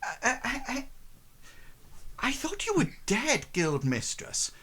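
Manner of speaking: high, stammering put-on voice; high shocked stammer at the guild mistress; startled Eliza. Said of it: "Eliza says".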